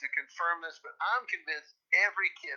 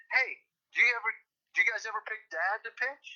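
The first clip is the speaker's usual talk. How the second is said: imitated eager dad; 6 semitones higher than usual. Dad retold asking his gaming kids.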